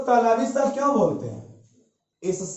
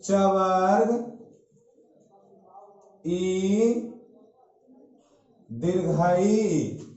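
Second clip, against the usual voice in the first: drawn out slowly, rising at the end; slow teaching demo; much slower than usual. Shown as a demonstration. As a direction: stretch the word slowly for the class. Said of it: "ये सब कहां से बोलते हैं?".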